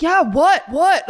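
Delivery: loud, high, exasperated retort; imitated snapping. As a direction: snap impatiently, as the retold angry man. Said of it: "he snaps".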